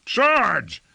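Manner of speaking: high, strained battle cry; croaking cry. A narrator's reading. Lord orders attack after breathing fumes, croaked cry. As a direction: shout through a throat thick with fumes. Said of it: "croaked Lord Fayed, his throat thick with fumes".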